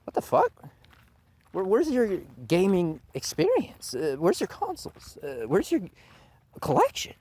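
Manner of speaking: high, disbelieving squeak of a question; incredulous. Retold or imitated. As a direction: act stunned at a fake gamer. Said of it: "I'm like".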